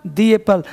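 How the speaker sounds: "the" said as "thee" before apple. Shown as a demonstration. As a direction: say "the" as "thee", stressed. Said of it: "the apple बोलेंगे".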